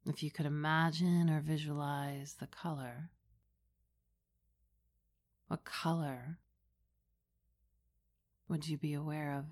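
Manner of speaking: hushed and unhurried, close to the ear; right in your ear. Acted. Leads a guided meditation.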